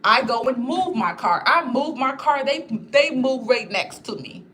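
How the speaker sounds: ordinary talk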